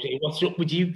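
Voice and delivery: lightly imitated brusque manager; Kenny's voice. Manager Kenny quizzes limping young striker, mock brusque. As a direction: toss it off like a blunt football boss. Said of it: "Like that".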